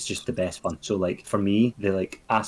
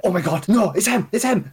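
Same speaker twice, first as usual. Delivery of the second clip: high, loud panicked cry; alarmed realisation; 10 semitones higher, 5 dB louder than usual. Retold or imitated. Telling mates about spotting the killer, high panic. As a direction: shout it like you just caught him. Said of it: "and I was like".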